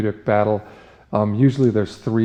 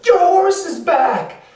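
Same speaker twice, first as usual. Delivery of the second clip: squealing falsetto excitement; thrilled villagers; 5 dB louder than usual. Acted. Villagers cheer the horse's return, high and thrilled. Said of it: "Everyone's like".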